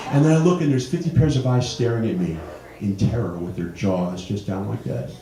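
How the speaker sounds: normal talking voice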